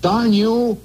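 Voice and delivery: slow, then high-pitched prissy outburst; mock-tough threat rising up high; mock holler. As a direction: play a timid guy trying to sound tough. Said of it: "holler to another guy".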